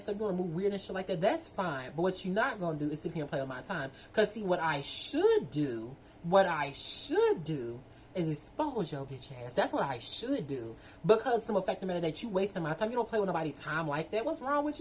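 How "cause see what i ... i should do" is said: slow, drawn-out warning on should; slow threat. Real, not acted. To the time-wasting man, slow threatening warning. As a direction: lean on should, slow and menacing.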